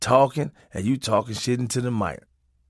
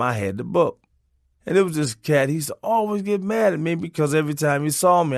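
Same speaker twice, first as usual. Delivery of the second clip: ordinary talk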